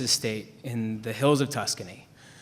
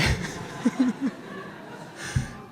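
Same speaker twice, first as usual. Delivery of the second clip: a high, airless burst of real laughter; breathless laugh; 12 semitones higher than usual. Real, not acted. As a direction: laugh silently at the memory. Laughing at his own story.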